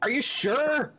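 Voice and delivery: high-pitched, sceptical query; doubting character voice. Acted. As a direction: question the order in a squeaky put-on voice.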